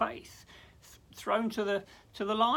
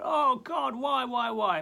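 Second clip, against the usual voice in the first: lament; 6 semitones higher, 8 dB louder, slower than usual. Retold or imitated. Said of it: "he poured out his heart".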